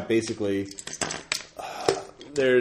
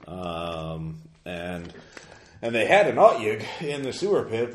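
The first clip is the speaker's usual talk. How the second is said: ordinary talk, nothing marked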